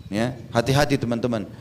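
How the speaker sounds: ordinary delivery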